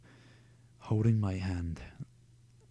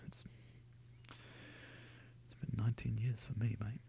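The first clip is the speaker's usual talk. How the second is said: whisper; 12 dB quieter, slower than usual. Real, not acted. Aside commenting on the book.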